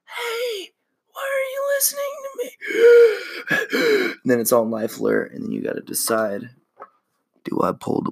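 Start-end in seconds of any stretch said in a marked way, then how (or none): 0.0-2.5 very high, fast squeaky voice; shrieking tiny demon begging; panicked demon. Retold or imitated. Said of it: "it starts getting a little bit". 2.6-4.2 very high, loud panicked gasp; squeaky gasp. Retold or imitated. Demon voice panicking.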